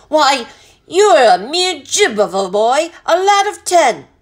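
high, loud scornful bluster; outraged captain's incredulous rant; angry bellow. A narrator's reading. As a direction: belittle the boy at full volume. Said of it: "he bellowed".

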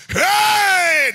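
long, high, loud yell; shouting. Shown as a demonstration. Neighbor overhears a believer praying, full-force shout. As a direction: let out a full-force hey. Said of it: "your neighbor hears you shouting".

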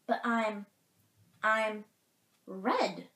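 loud, halting cardinal, lightly voiced; hesitate, then announce it loudly; lame announcement. A narrator's reading. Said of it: "announced the cardinal, not knowing what else to say".

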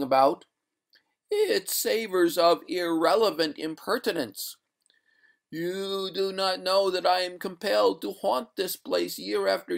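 eerie high ghost, stretching the phrase endings; indignant water ghost. Acted. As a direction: intone the grievance like a doomed spectre. Reader voices the water ghost.

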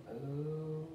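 long, restrained moo; subdued cow moo. Acted. Puppy George at the vet, subdued moo.

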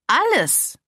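outraged cry, pitch leaping high and loud; voice cracking with outrage. A narrator's reading. Mother exploding at her daughter's question, near-cracking outrage. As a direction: shriek it in indignation. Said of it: "Stimme überschlug sich fast vor Empörung".

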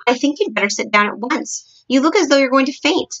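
lightly voiced sharp queen; firm instruction rising in urgency; rather sharp. A narrator's reading. Queen to a maid about to faint, rather sharp. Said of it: "the famous voice was saying rather sharply".

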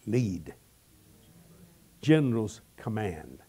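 slow, low, weighty pronouncement; solemn voice of God. Acted. God telling the preacher why to stay, slow and solemn. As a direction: lay down each word slowly like a command. God's voice quoted in sermon.

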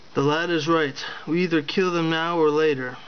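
ordinary delivery, nothing marked

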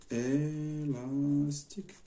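ÉLASTIQUE slowly sounded out as if writing it; drawn out. Shown as a demonstration. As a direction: stretch out ÉLASTIQUE syllable by syllable, quietly. Says word while writing it.

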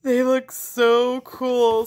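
drawn out in delight; delighted admiration. Real, not acted. A dyer admiring her freshly arrived cards, slow delighted praise.

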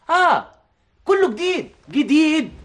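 high, enthusiastic exclamation; excited sales pitch. Acted. Director wooing star by phone, excited. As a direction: sell it with rising excitement.

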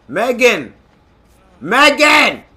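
calling out. Real, not acted. Calling teammate Megan over to help, urgent shout. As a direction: shout her name to get her attention.

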